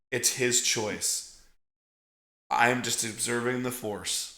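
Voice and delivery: low, soft and measured; gentle, sincere explanation. Retold or imitated. Jedi explaining to a mother about her son, gently sincere.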